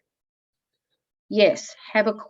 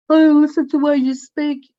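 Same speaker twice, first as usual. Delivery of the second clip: put-on higher, louder scornful teen voice; daughter's voice, mocked by her mother, indignant and loud; 9 semitones higher, 7 dB louder than usual. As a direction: throw it back like a sulky daughter. Daughter's retort voiced in story.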